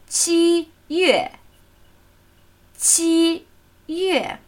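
"qi" high and level, "yue" falling from high; first then fourth tone. Shown as a demonstration. Teacher showing the month July, high then falling tone. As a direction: hold "qi" up, then let "yue" drop. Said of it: "Der erste Ton und der fallende vierte".

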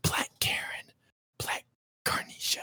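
hushed whispered threat; whispered aside. Retold or imitated. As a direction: breathe it low and secret.

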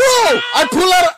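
excited laugh. Real, not acted. S1 laughing, high and slow.

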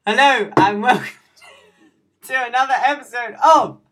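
loud, bright show opener; announcer intro. Acted. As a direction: welcome them like a host. Podcast intro.

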